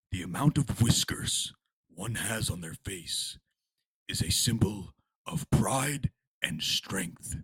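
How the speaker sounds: a slow grave speech of pride and vengeance; solemn threat. Acted. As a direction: tell it like a proud warrior's warning.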